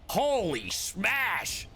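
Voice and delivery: normal talking voice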